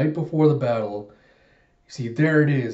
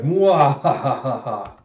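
loud, slow mwahaha cackle; hammed-up villain cackle; villain's evil laugh; 5 dB louder, much slower than usual. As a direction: gloat in a big, drawn-out stage laugh. Villain laughs at sunk ships.

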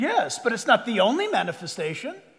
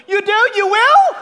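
mock amazed disbelief; 8 semitones higher, 8 dB louder than usual. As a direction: pitch jumps way up, louder, with a laugh. Convert unexpectedly says yes.

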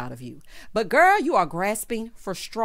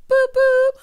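high, loud spoken car horn; mock horn honk; 9 dB louder than usual. Quoting a reality star to recap viewers, loud horn imitation.

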